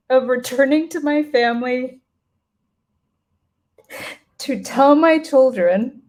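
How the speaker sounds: reading through real grief, barely holding it together; choked up. Real, not acted. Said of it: "I'm not sure I'm gonna get through this part".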